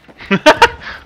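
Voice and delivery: loud, high burst of laughter. Real, not acted. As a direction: laugh it off in shock. Laughs after team kill.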